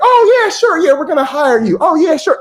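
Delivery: high, mocking fake agreement; sarcastic hiring panel. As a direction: play the committee humoring a fool. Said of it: "they're gonna be like".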